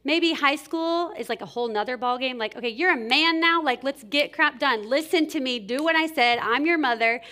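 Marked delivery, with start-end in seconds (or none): 2.6-7.2 raised, louder bossy mom voice; mother scolding her teenage son, loud and bossy; stern mother scolding. Pretend. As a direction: lay down the law to your grown son.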